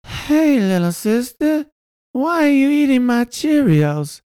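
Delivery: very high, slow silly voice; high character voice. Retold or imitated. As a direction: squeak it out like a kid. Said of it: "That, that voice was Son of Fauci".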